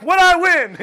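high, loud jackpot cry; mock thrill, voice shooting up; excited winner. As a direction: shout it like a game-show winner. Mock game-show winner at the beeps.

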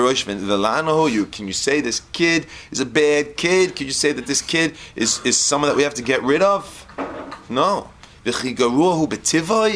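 loud, high, impassioned sermon delivery; fiery rabbi's sermon, rising and pressing; preaching at full force. Acted. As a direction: preach it out to the whole room. Preaching opening, Hebrew then English.

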